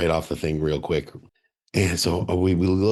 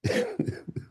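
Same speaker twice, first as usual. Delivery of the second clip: laughter; 6 dB quieter than usual. Real, not acted. Laughing after impression.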